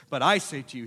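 "But I say to you" with a loud punch on "I"; emphatic. Acted. Preacher quoting Jesus' emphatic statement, "I" stressed. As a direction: lean hard on "I" in "But I say to you". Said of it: "this is an emphatic statement here".

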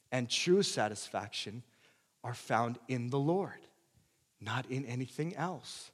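ordinary talk

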